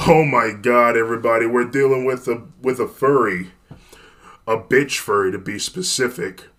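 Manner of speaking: loud, jeering tough guy; mocking bully voice. Thug mocks the three.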